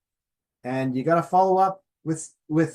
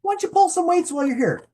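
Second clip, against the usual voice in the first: higher, louder cheerful nudge to yourself; upbeat self-coaching; 11 semitones higher, 5 dB louder than usual. Retold or imitated. Coaching yourself at the gym, bright and louder. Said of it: "then as".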